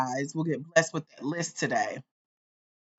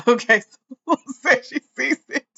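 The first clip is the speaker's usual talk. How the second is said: laughing; 6 semitones higher, 4 dB louder than usual. Real, not acted. Host breaks up laughing mid-sentence.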